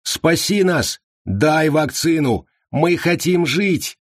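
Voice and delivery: high, slow pleading cry; desperate pleading shout. Acted. Desperate crowd begs for vaccine.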